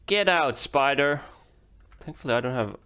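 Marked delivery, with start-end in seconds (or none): 0.1-1.2 loud, sharp yell at the spider; shout. Real, not acted. Gamer shouting at a spider in the game. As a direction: bark it at the creature to scare it off.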